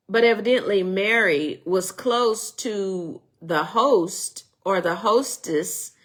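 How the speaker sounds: ordinary delivery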